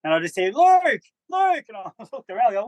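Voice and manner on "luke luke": called out high and loud, Luke, Luke, across the street; calling out a name. Retold or imitated. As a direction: shout the name Luke to get his attention.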